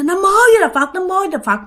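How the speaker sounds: high-pitched frightened chant; panicked Buddhist invocation. Acted. Terrified sleeper invokes Buddha against the ghost, high panicked cry. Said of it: "bạn mới la, bạn niệm Phật nha".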